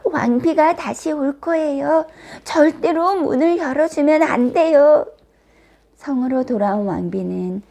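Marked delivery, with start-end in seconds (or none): 0.0-5.1 high-pitched little dwarf voice; emphatic storybook dwarf; dwarfs speaking together. A narrator's reading. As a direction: warn the princess earnestly, pitched up. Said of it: "기뻐하며 입을 모아 말했어요".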